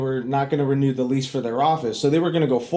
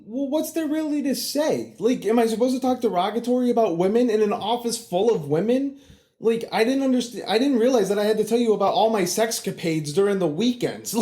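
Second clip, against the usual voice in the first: exasperated; 7 semitones higher than usual. Retold or imitated. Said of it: "And I'm like".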